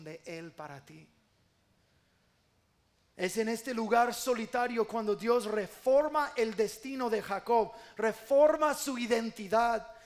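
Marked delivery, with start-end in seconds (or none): 3.1-9.9 loud, emphatic pulpit delivery; full-force preaching. Real, not acted. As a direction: proclaim it to the whole church with force. (prédica enérgica y en voz alta)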